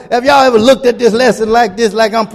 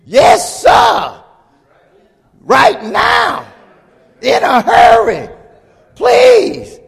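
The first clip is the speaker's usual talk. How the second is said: eager shout; 13 semitones higher, much slower than usual. Pretend. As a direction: shout it out, desperate to be healed. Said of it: "my response is going to be".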